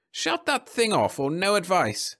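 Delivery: slow, high irritated order; snapped. A narrator's reading. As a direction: snap a demand at the caller. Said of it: "snapped the man".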